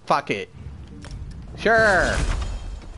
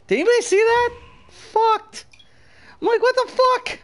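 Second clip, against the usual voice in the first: much higher, squealed incredulity; high-pitched disbelief; 14 semitones higher than usual. Real, not acted. Gamer asks viewers about the glitch, high-pitched disbelief.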